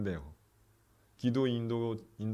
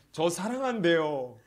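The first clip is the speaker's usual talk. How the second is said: slow, high, louder tearful repeat; mock tearful quote; 7 semitones higher, 5 dB louder, slower than usual. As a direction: echo the friend's words through tears, drawn out. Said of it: "그러면서 막 울어요". (눈물 섞인 느린 반복)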